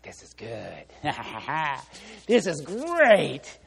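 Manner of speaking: mock scheming wife gloating slowly; wife plotting against her husband, gleeful scheming; vengeful glee. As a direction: savor the revenge plan, hammed up. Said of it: "with revenge in her eyes".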